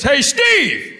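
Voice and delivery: another guy hollering across the theater; high, loud yell; calling out. Retold or imitated. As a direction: shout it out to a friend up front. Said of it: "Another guy goes".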